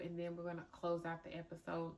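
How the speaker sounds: ordinary talk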